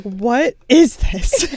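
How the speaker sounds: slow, drawn-out disbelief; mock scolding. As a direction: call her out, slowly. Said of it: "She's like".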